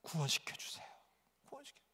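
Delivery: whispered, pleading prayer; very soft secret plea, acted out; imitated inward prayer. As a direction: whisper it as if praying silently. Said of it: "속으로는 뭐 어떻게 속으로?".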